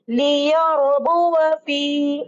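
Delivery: लियरबुव फी recited very slowly, drawn out; slow tajweed demo. Shown as a demonstration. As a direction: recite it like a teacher modelling. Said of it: "इस तरह से पढ़ेंगे". (लियरबुव फी धीमी तिलावत)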